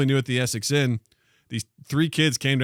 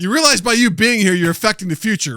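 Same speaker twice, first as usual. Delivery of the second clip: high, loud mock reproach; exasperated lecture; 8 semitones higher, 8 dB louder than usual. Past self warning the time traveller, loud exasperation. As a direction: lecture the intruder, worked up.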